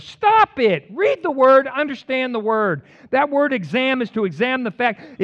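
loud, high fired-up preaching. Real, not acted. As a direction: hammer each phrase home. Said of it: "Sorry, I'm fired up today".

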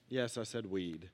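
flat low aside, slow; deadpan. Real, not acted. Pastor confirming to the crowd, deadpan and quiet. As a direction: drop it dryly under the breath, slowly.

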